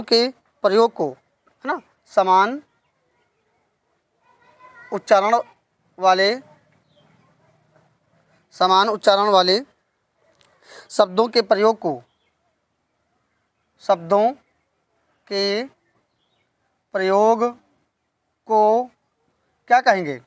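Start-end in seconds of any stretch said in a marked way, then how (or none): 2.1-6.4 word by word with long pauses; dictation pace. Shown as a demonstration. 13.8-18.8 each word drawn out and set apart; dictation pace. Shown as a demonstration.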